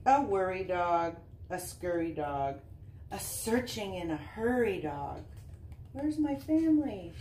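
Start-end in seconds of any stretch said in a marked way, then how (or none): none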